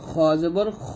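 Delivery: খ said full and heavy with a dark, rounded vowel, never খা; the teacher demonstrates the heavy letter to students, full and dark; heavy kha. Shown as a demonstration. ভারী খ উচ্চারণ. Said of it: "বলতে হবে খ".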